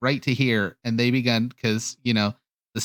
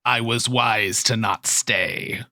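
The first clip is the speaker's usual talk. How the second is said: slow, self-satisfied reptilian ruler; lizard king in Draconic; slower than usual. Acted. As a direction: deliver it slowly as a fleeing monster king. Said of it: "You just hear in Draconic".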